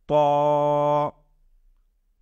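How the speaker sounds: "ত" as a heavy "t" with a long rounded vowel; model pronunciation. Shown as a demonstration. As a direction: hold each "ত" long and steady. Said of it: "উচ্চারণ করার সময় পড়ব".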